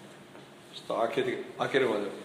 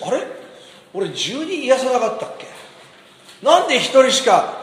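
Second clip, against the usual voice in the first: a puzzled, raised-pitch "wait, where are the rest?"; mock bewilderment, pitch lifted; surprised Jesus; 5 semitones higher than usual. Jesus surprised that only one leper returned, puzzled. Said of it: "イエス様びっくりして".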